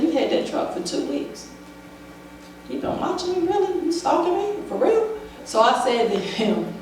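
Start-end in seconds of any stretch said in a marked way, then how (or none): 0.0-5.1 high, needling mock accusation, rising at the end; mock suspicious accusation. She imitates her ex accusing her of stalking, high and needling. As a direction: paint him as a suspicious, prying man.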